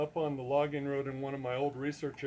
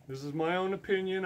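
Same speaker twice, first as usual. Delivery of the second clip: ordinary delivery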